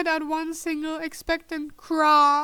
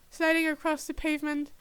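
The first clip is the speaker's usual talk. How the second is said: usual speaking voice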